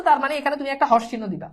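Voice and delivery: ordinary talk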